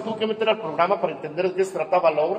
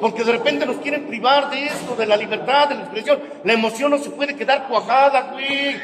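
raised preaching voice throughout; preaching at full force; 5 semitones higher than usual. Real, not acted. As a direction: preach it hard. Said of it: "preaching at full force".

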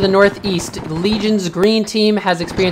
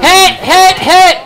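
man down shout; 14 semitones higher, 8 dB louder, much slower than usual. Acted. Calling hits in firefight.

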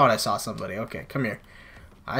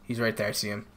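normal talking voice

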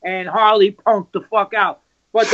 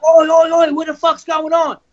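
a loud, alarmed "oi oi oi" that breaks in on a fight; 4 semitones higher, 3 dB louder than usual. Retold or imitated. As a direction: bark it out like a rock star storming into the dressing room. [name] bursting in on fight.

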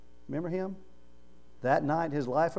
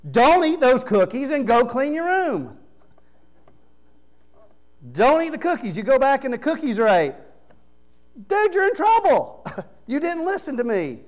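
put-on higher, louder scolding of a disobedient kid, building to a sharp rebuke; mock parent scolding; 8 semitones higher, 5 dB louder than usual. Parent finding the cookies eaten, mock scolding, rising to a loud rebuke. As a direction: play the exasperated parent catching the kid.

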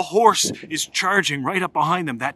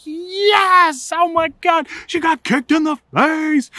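high, loud gleeful shriek; gleeful kid shout; 12 semitones higher, 4 dB louder, slower than usual. Retold or imitated. Boys cheering the kick in a shrill gleeful shout. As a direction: cheer like a jerk kid watching someone get hurt. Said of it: "We're like".

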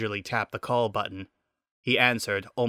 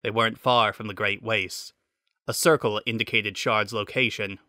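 plain reading voice

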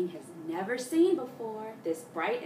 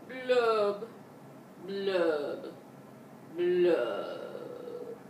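pouty blub; 2 semitones lower, much slower than usual. Shown as a demonstration. Teacher shows kids a pouty blub. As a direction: pout your lips on every blub. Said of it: "maybe with a pouty face".

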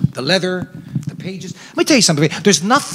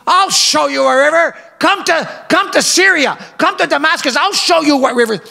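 boastful Naaman; 10 semitones higher than usual. Acted. Naaman bragging to the prophet about Damascus rivers, high and proud. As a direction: show off your homeland's rivers with scorn. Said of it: "Naaman is thinking naturally".

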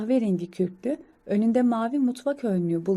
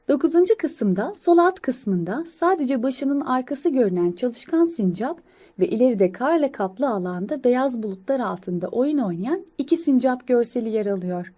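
ordinary delivery

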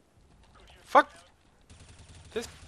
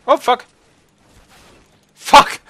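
loud sudden cursing; angry outburst; 11 semitones higher, 8 dB louder, slower than usual. Real, not acted. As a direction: swear out loud in shock. Sudden death in game.

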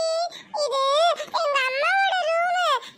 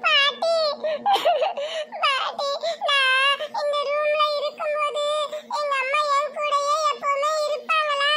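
put-on tearful little girl missing her mum; crying child. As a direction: weep through the words like a homesick child. Child misses her mother, crying.

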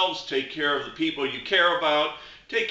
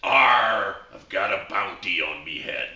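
growly pirate, lower pitch; 6 semitones lower than usual. Acted. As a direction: hit the arr like a salty pirate. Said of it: "And the pirate says".

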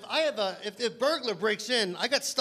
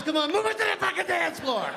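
pushy shout; 8 semitones higher than usual. Acted. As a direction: bark it like a pushy creep. Gunman pickup line acted out.

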